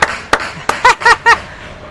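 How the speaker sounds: high, derisive cackle; sarcastic cackling; mocking laugh. Real, not acted. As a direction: burst into a scornful high laugh. Laughs after sarcastic outburst.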